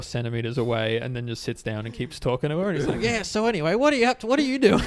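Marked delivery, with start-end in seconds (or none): 3.0-4.8 high, loud, over-eager questioning; crazed pestering. Retold or imitated. Crazed man beside girl who keeps talking, pestering. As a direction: imitate a crazed man forcing small talk.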